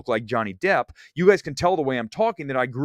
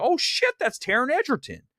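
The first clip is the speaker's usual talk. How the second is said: high-pitched jolt of recognition; retold surprise; 12 semitones higher than usual. Recognising Taron Egerton at the gym, acted astonishment. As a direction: act out suddenly spotting a celebrity.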